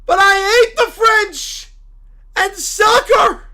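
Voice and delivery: loud, anguished preacher's cry; a wounded zealot shouting at the sky; outraged preacher. Acted. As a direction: howl it like your church just fell. Character cry.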